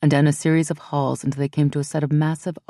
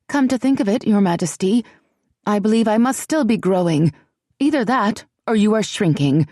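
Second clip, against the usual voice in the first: put-on haughty, higher young woman's voice; bold retort; 6 semitones higher than usual. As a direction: stare down the queen with cool sass. Ellie insults tiny queen boldly.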